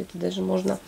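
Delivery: ordinary talk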